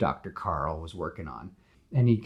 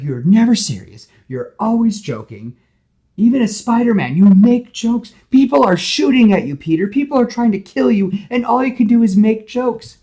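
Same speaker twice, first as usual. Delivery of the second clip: high, loud furious yell; 14 dB louder than usual. Retold or imitated. Gwen yells at Peter about his jokes, high and loud. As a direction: shout it at him, fed up. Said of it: "Gwen is yelling".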